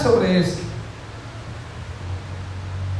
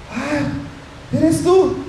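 startled recognition; 4 semitones higher, much slower than usual. Acted. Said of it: "escucha la voz de su maestro y dice".

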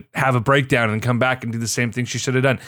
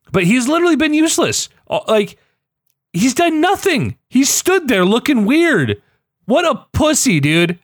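exasperated rant; 10 semitones higher than usual. Real, not acted. Fan venting about Jon Snow, exasperated rant. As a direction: let the pitch soar in disbelief at how useless he is. Ranting about a useless character.

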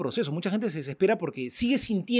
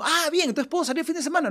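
put-on hopeful kid; 11 semitones higher than usual. Son voiced, hopeful.

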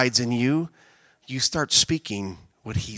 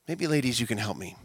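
normal talking voice

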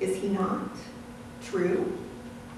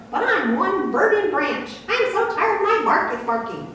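high, hammed-up exhausted branch voice; weary grape branch; 9 semitones higher than usual. Burdened branch whines to Farmer Jones, high and hammed-up weary. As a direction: play an overworked little branch groaning about its load. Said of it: "answered one more".

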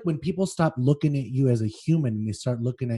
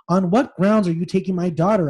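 firm, raised and higher, holding steady; firm demand; 6 semitones higher, 7 dB louder than usual. Acted. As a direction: stand your ground with the police. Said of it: "I said firmly".

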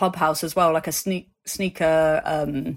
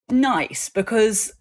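usual speaking voice

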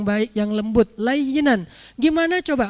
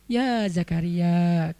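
low, very slow, drawn-out call; gentle divine call; 5 semitones lower, 3 dB quieter, much slower than usual. Shown as a demonstration. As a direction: call the name gently, unhurried. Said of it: "Dan itu nggak usah diteriakin".

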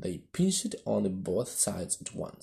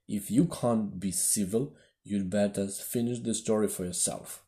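ordinary delivery, nothing marked

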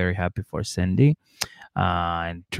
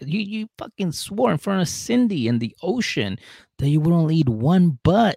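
high, scolding mock rant; 8 semitones higher than usual. Host scolding Marcelino for cheating, mock outraged. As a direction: tell him off, pitched up.

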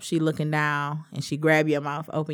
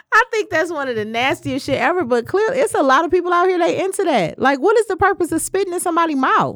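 high and loud, laughing disgust; disgusted excitement; 12 semitones higher, 9 dB louder than usual. Real, not acted. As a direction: rant about it, grossed out and laughing.

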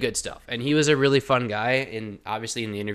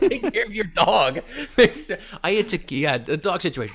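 joking through laughter, higher and louder; laughing; 6 semitones higher, 4 dB louder than usual. Real, not acted. Co-host teasing host about the dog, laughing.

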